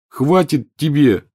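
slow, loud plea to stop; pleading. A narrator's reading. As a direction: beg her to give it a rest. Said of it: "взмолился Рон".

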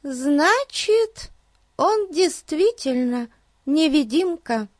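put-on very high little-girl voice, said with conviction; high, childlike and convinced, in short phrases; Бетси. A narrator's reading. Girl deciding the thief is invisible, high and convinced. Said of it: "с убеждением сказала Бетси".

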